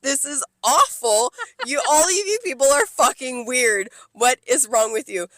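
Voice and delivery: loud, high, comic outburst; exasperated rant. Retold or imitated. Server ranting at weird Friday customers, exasperated and loud. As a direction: rail at all of them in mock outrage.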